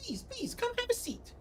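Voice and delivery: very high tiny fairy voice; pixie voice. Acted. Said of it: "Wasn't prepared for the pixie voice".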